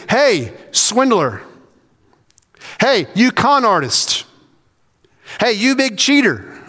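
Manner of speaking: high-pitched, slow accusing hail; mock name-calling. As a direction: call out insults with swooping pitch. Mimicking insulting calls to Zacchaeus.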